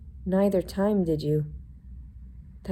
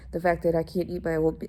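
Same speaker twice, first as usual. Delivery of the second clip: usual speaking voice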